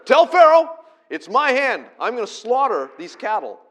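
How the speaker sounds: God's furious shouted message. Acted. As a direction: hurl it out like God sending word to Pharaoh. Preacher voicing God's threat.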